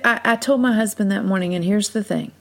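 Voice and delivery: normal talking voice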